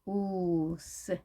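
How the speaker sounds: "우스" drawn right out, long oo then hissed s; slow sound demo. Shown as a demonstration. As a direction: stretch "우스" very slowly for learners. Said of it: "자, 보겠습니다".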